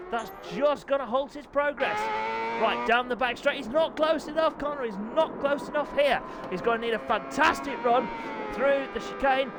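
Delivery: fast, keyed-up race commentary; live play-by-play. Real, not acted. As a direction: call the race as it unfolds.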